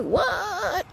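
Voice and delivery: high, shocked what; a startled high-pitched what; stunned what. Retold or imitated. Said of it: "I'm like".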